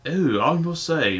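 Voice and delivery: a light mock posh British, slow; lightly voiced British dub actor, drawn out; British accent. Reviewer mocking the British dubbing, light British accent. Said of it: "they have these people with British voices".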